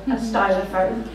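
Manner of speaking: normal talking voice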